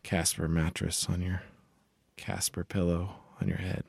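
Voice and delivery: soft, slow sponsor read; mock ad read.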